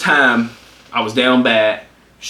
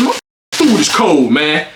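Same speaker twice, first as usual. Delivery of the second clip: retold angry complaint; 2 semitones higher, 3 dB louder, slower than usual. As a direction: complain like an annoyed dad, slowly. Retold dad complaining about food.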